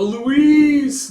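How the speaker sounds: high, loud, drawn-out call; stretched, high-pitched holler. Acted. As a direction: call the name out long and high. Calling out for Louise in the story.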